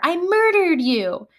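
high, drawn-out playground call; mock child's shout. Student tags a classmate in play, high mock shout. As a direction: call it out like a kid in a game.

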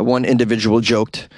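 usual speaking voice

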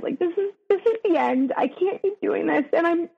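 upset, voice rising as the sadness returns; real sadness in voice. Real, not acted. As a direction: hold back tears while telling it. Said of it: "you could hear it in my voice".

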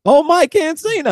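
high, loud excited exclamation. Real, not acted. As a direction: cheer the name out gleefully. Excited greeting of name.

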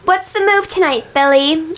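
put-on high-pitched party-girl stage call; pop-star impression. Imitating the pop singer greeting Philly, high put-on voice. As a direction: address the crowd in a cutesy high voice. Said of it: "what else did she say".

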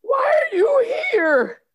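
slow, high, loud near-crying cry; frightened wail. Acted. Scared of the future visitors, a near-crying shout. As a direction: wail it out in terror. Second time traveller arrives.

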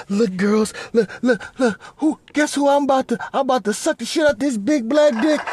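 high, squealing imitation of an excited wife; mocking wife. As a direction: squeal it like a giddy wife bragging to friends. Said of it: "She was like".

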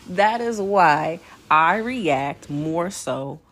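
normal talking voice

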